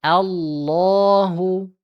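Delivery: Allahu drawn out very slowly; long Allahu. Shown as a demonstration. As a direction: Allahu with the long vowel held. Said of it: "Ingat ya". (Allahu dibaca panjang)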